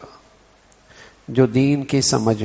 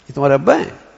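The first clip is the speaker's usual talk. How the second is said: disbelieving, sharp rise on "ابا ہیں"; surprised; faster than usual. Shown as a demonstration. Amazed at how father and child differ, surprised. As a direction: ask in astonishment, swooping up at the end. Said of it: "یا حیرت ہو رہی ہے".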